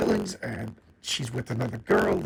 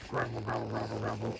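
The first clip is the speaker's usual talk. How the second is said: low-key, softer repeated grumble; mock grumbling; 3 semitones lower, 7 dB quieter than usual. She answers the jealousy jab, mock grumbling. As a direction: imitate someone grumbling under their breath. Imitating a character's grumbled reply.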